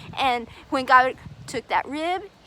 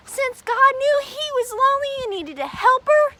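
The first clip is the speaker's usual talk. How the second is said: ordinary delivery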